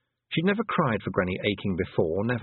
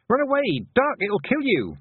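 urgent warning shout; 4 dB louder than usual. A narrator's reading. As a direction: yell it high and loud, in alarm. Warning the Feegles of the buzzard.

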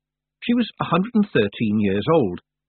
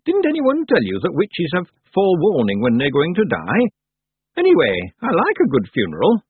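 put-on higher-pitched old witch character; old witch, brisk; 7 semitones higher, 4 dB louder than usual. Old witch explains to girl.

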